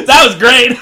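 loud, high burst through laughter; delighted, laughing outburst; laughing hard. Real, not acted. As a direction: crack up while getting the words out. Cracking up at the other's line.

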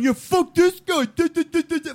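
high, loud sneering mock voice; shrill-sounding caricature of a gloater; mocking leftist.

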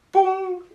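high, loud sound effect; pung sound. Acted. Dwarf keeling over, high loud bump noise. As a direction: pop out a high cartoon thud.

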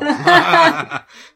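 big real laugh; loud laugh. Real, not acted. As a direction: burst out laughing loudly. Laughs at friend's reply.

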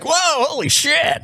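high, slow amazed whoa; amazed gasp. Retold or imitated. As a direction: act stunned and impressed. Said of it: "They're like".

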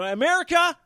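loud, punchy call-out; loud comic rant at America; ranting catchphrase. Acted. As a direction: shout the segment slogan at the nation.

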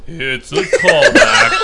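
slow laughing announcement; drawn-out laughing tag line; laughing radio drop. Pretend.

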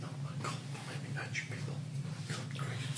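hushed annoyed whisper. Real, not acted. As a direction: whisper it so others won't hear. Annoyed aside to other players.

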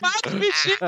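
high mock outraged squeal; imitating Sara. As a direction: squeal it like a scandalised animal lover. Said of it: "E Sara".

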